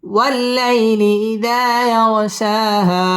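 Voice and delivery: slow, melodic tajweed chant with long held vowels; Quran recitation. Real, not acted. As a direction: recite each verse slowly, pausing between them. Teacher recites the surah melodically. (ধীরে সুর করে কুরআন তিলাওয়াত)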